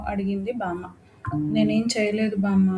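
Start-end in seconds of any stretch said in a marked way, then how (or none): none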